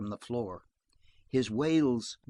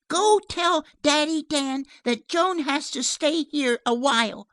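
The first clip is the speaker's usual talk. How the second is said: put-on commanding little girl; 14 semitones higher, 7 dB louder than usual. Child orders the dog away.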